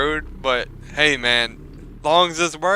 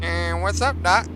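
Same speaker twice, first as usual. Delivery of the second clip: lightly voiced cartoon rabbit; a hint of Bugs Bunny; 5 semitones higher than usual. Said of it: "Bugs Bunny, man".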